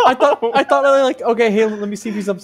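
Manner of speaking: laughing. Real, not acted. Laughing disbelief among players.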